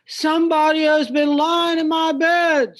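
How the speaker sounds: big bear grumbling about his porridge; great big bear voice. Acted. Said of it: "in his great big voice".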